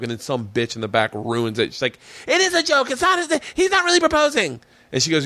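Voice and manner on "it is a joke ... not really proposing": very high, put-on voice blurting out the secret; mock heckler. Woman in the back ruining the prank, mock heckling. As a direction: imitate a spoilsport in a squeaky pitch. Heckler spoils the fake proposal.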